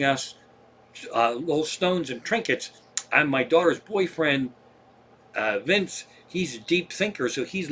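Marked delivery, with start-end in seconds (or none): none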